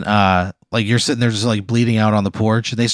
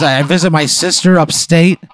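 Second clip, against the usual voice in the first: louder, higher indignant rant; screaming at husband; 6 semitones higher, 5 dB louder than usual. Acted. Wife ranting at husband about her trip away, mock screaming. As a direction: raise pitch and volume, outraged. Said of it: "starts, like, screaming at him".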